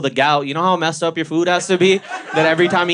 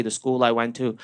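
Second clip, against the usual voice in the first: ordinary delivery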